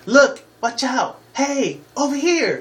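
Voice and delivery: very high, drawn-out fairy calls; loud piping mimicry of a pestering helper; Navi. Gamer mocking the annoying game guide, high calling voice. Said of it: "just like with Navi".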